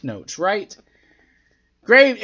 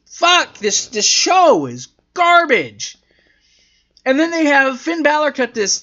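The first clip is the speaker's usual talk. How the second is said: fed-up rant; 6 semitones higher, 3 dB louder than usual. Real, not acted. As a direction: punch out each word in disgust. Rants about the bad show.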